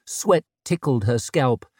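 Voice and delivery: usual speaking voice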